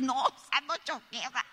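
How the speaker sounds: llanto imitado, entrecortado; llorando. Acted. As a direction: contesta como sin poder hablar del llanto. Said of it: "Llego a la casa yo llorando así". (sollozos actuados que se van apagando)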